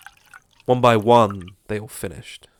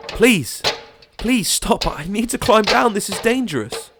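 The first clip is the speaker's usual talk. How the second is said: much higher, frantic begging; desperate plea; 12 semitones higher, 5 dB louder than usual. Acted. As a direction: plead for your life on the ladder. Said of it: "His desperate pleas".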